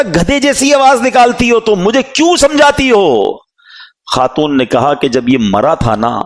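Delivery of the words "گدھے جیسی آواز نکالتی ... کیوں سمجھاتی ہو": high, scornful retort thrown back at his mother; mocking son. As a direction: sneer at her like a rude drunk son. Son mocks his pleading mother.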